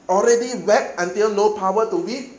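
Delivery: ordinary delivery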